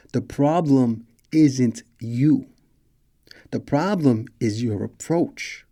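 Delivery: slow, each word weighed; slow and deliberate. Shown as a demonstration. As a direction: spell it out slowly so it sinks in. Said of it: "listen to me clearly".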